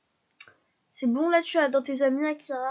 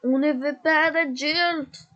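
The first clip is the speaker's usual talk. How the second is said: slow, drawn-out put-on voice; mock stern refusal; 3 semitones higher, 4 dB louder, slower than usual. Speaking for her Sim to the unwanted adult, slow mock-stern voice. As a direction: lay down the rule slowly like a snooty kid.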